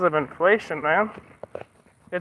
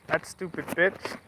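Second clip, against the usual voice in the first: slow, much softer muttered insult; under-breath insult; 2 semitones lower, 11 dB quieter, slower than usual. Real, not acted. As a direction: mutter it to yourself, slowly. Angry insult after rant.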